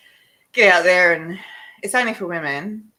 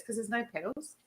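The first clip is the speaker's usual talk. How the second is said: ordinary talk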